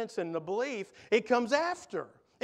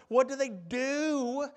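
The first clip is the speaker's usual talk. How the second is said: "do" drawn out long and pitched up; slow question leaning hard on a lengthened "do"; stress on do; 4 semitones higher, slower than usual. Shown as a demonstration. Preacher asks congregation about the next verse, stress on "do". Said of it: "Emphasis on the word".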